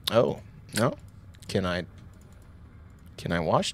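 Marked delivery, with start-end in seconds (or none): none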